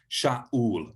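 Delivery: Shaul with a catch in the throat between a and u; glottal stop in Shaul. Shown as a demonstration. Teacher models Shaul with a glottal stop. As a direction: Shaul said Sha'ul, air stopped before ul. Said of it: "Do you hear the way that I stopped".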